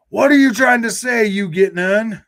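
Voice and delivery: high, loud mock shout at the chatter; exasperated shout. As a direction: yell the question like you're fed up.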